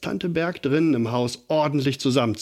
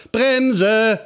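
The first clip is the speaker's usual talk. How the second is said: very high, louder screaming call; panicked shout; 11 semitones higher, 4 dB louder than usual. A narrator's reading. Lotta racing downhill screams to brake. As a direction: scream it as the bike runs away. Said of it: "schrie Lotta".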